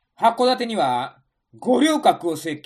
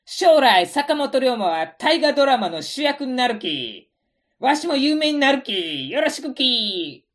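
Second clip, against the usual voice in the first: Tosa dialect; 5 semitones higher, 3 dB louder than usual. Acted.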